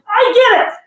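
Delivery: put-on high, loud kid's exclamation; imitating a daughter's big discovery; excited little kid. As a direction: burst out like a thrilled child. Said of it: "she was so excited".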